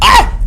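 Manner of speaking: short, piercing high scream; sudden loud shriek of fright; startled shriek. Real, not acted. Player scared mid-game, startled shriek.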